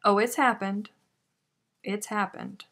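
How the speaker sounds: usual speaking voice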